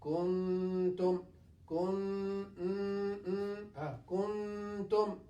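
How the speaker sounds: Kuntum with the nun hidden in a held hum; Kun- hummed, then kuntum. Shown as a demonstration. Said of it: "it will be hidden like this".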